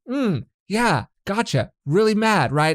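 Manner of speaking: high, loud exaggerated calm; mock soothing. Partner calming the angry one, high and loud. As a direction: coo like a patient listener. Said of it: "And then I'm like".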